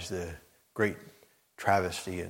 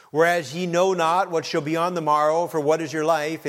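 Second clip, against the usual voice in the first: higher, louder verse; forceful scripture reading; 8 semitones higher, 7 dB louder than usual. Acted. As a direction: declaim the verse.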